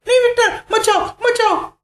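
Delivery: high-pitched, frantic pleading scream; panicked scream. Acted. Wife begging her husband not to go, panicked scream. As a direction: cry out to stop him, very high.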